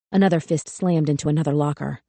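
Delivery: usual speaking voice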